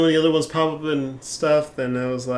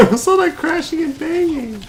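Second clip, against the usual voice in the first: mocking high voice; 13 semitones higher, 3 dB louder than usual. Streamer mocks game character's line.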